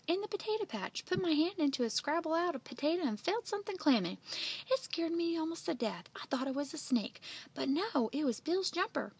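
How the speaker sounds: high-pitched, excited telling; crying out. Acted. Bill's mother recounting catching the bullfrog, excited cry. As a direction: tell the scare in a lively high voice. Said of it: "she cried".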